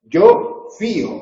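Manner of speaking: "fío" said as two syllables, fí-o, with the stress on the i. Shown as a demonstration. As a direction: stretch out "fío" slowly, leaning on the í. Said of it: "ahí tenemos que poner acento sobre la I". ("fío" pronunciado en dos sílabas, con el golpe en la í)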